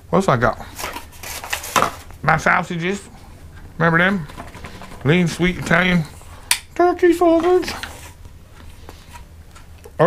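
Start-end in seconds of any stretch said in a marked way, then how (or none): 5.0-7.7 excited. Real, not acted. Showing off sausages.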